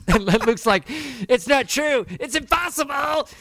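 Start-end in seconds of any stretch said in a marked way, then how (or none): 1.3-3.3 much higher, louder, anguished cry of denial; Luke impression. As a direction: wail it like Luke hearing the truth. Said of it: "That was a great impression".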